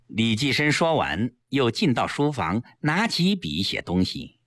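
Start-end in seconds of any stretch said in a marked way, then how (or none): none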